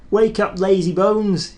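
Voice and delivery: high, loud teasing little engine; cheeky whistle. A narrator's reading. Thomas teasing tired Gordon on the siding, high and cheeky. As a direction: tease the sleepy big engine. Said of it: "he whistled".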